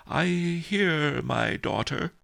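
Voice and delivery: put-on high, tearful old abbot; a touch of tearful sniffling. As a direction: sniffle through the words. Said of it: "he sniffled".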